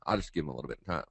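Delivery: usual speaking voice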